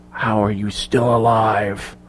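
slow, soft, drawn-out question; hushed and deliberate, barely containing it; menacing disbelief. A narrator's reading. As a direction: interrogate with quiet menace. Norn confronts Clark at gunpoint.

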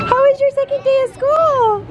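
very high, bright-eyed singsong; real high-pitched enthusiasm; excited mom greeting. Real, not acted. Mom greets child after school.

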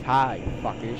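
ordinary talk, nothing marked

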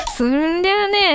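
normal talking voice

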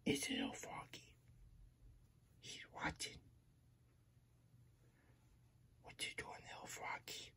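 hushed, breathy whisper so the frog won't notice. Acted. Puppet creeping up on a little frog, whispering. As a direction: whisper it like you're sneaking up on a tiny frog.

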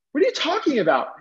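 high-pitched retold disbelief; incredulous. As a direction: throw the question back at dad, pitch way up. Teen disbelieves dad's legal warning.

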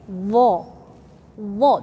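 "vo" with the tongue out; correct vo. Shown as a demonstration. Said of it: "Don't say zo".

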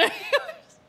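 high, wheezing laughter through words; drawn-out, laugh-choked squeal; breaking up laughing. Real, not acted. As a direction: lose it while reading the line. Breaks up reading, then retries.

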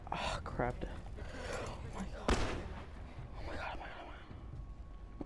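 frantic whispering while hiding; panicked whisper. Real, not acted.

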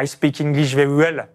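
English in a thick French accent; Burgundian French accent. Shown as a demonstration. Host demonstrating his catastrophic English, heavy Burgundian French accent. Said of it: "vous avez vu mon accent".

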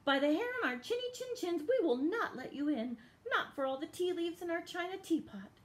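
fast, high defiant wolves; high little wolves voice. Acted. Wolves defying the pig.

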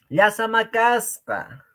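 "Llasa macasca" muy agudo y lento; exaggerated high example. Shown as a demonstration. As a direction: estirarlo arriba, despacio. Ejemplo en tono exagerado. (frase de ejemplo alta y lenta, exagerada)

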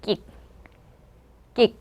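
short "끽" with stopped k ending; tense k, cut "끽" off short; teacher's isolated model of "끽"; demonstrating "끽". Shown as a demonstration. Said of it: "이렇게 읽어주시면 돼요".

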